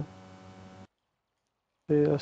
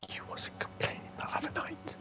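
whisper; 11 semitones higher, 18 dB quieter than usual. Pretend. Teasing a friend over game chat.

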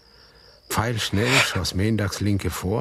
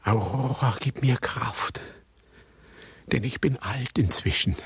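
unvoiced, weary whisper; 4 semitones higher than usual. Old man whispering a plea to his dead wife Aurora. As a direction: breathe the words, worn out with age.

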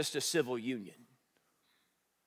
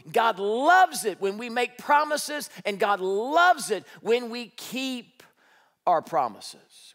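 slow emphasis; 4 semitones higher, 3 dB louder, slower than usual. Real, not acted.

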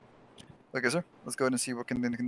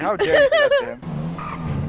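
high, slow, loud hammed-up indignation; mock outrage; 11 dB louder, slower than usual. Medic mock-defending his friend, hammed-up high outraged shout. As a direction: clutch your pearls and shriek it out slowly.

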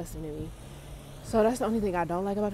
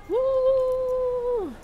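excited cheer. Real, not acted. Celebrating last day.

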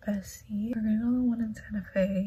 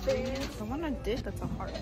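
ordinary talk

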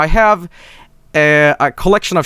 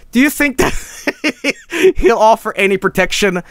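high, slow, broken up by giggles; laughing; 8 semitones higher than usual. Real, not acted. Streamer doubting Norton against a barrage of viruses, laughing. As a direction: struggle through the question while laughing.

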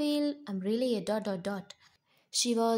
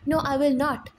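loud, higher flat refusal; defiant shout; 5 semitones higher, 7 dB louder, faster than usual. Acted. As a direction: refuse him at full voice. Wife refuses husband.

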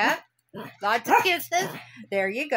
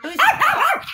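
a sudden squeaky high "Who's the boss?", louder; squeaky high question; 7 dB louder than usual. Pretend. Teasing the dog, boss game.

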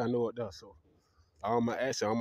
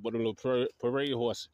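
ordinary delivery

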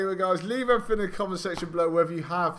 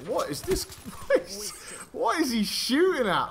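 high, squeaky questions broken by laughter; real disbelieving laugh; incredulous laughing; 10 semitones higher than usual. Real, not acted. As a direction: ask in baffled amusement, voice pitched right up. Baffled laughing at opponent's shooting.

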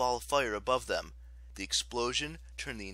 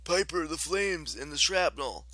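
raised, higher-pitched call; alarmed warning; 6 semitones higher, 5 dB louder than usual. A narrator's reading. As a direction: warn her over the battle. Shouted warning in battle.

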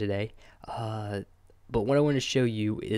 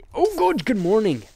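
high, loud surprised good morning; excited greeting; 10 semitones higher, 7 dB louder than usual. Real, not acted. Gamer greeting his pets, high and loud. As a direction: burst out greeting the animals.

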